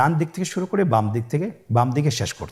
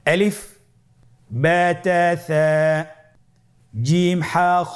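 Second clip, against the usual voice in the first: recited letters; 4 semitones higher, slower than usual. Shown as a demonstration. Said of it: "আবার বলুন".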